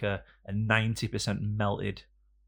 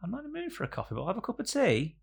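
mock posh date voice; 13 semitones higher, faster than usual. Acting out his first-date self, high falsetto. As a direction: squeak it out like a prissy date. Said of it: "And you go".

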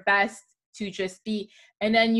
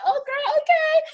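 put-on high, loud squealed oh girl okay; mock excited approval; 7 dB louder than usual. Friend cheering on her crush, high squeal. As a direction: squeal it like a thrilled girlfriend.

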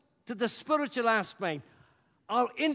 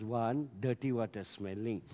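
grumbling Naaman; 7 semitones lower than usual. Pretend. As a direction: mutter about the dirty water in disgust. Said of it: "he got up and says".

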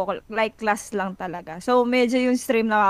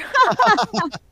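high-pitched real laughter; amused laugh; 9 semitones higher, 4 dB louder than usual. Real, not acted. Coach laughing at own remark to a commenter.

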